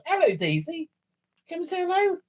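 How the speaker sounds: very high cooing to a pet; coaxing pet voice. Real, not acted. Presenter calling the dog over, high doting coo. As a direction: coax the dog over sweetly.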